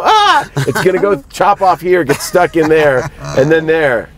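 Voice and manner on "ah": mock scream. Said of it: "I go".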